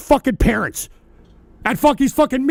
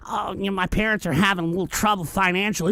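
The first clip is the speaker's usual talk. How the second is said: a soft, lower mock voice of the chatter; mocking whiny chatter; 5 semitones lower, 7 dB quieter, faster than usual. Streamer parroting a chatter's excuse, mild mocking voice. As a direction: sneer his excuse back at him quietly.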